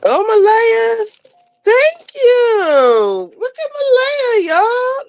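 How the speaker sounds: a joyful shout about a new Patreon member; overjoyed. Real, not acted. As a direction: shout her name like a gift arrived. New member, near tears.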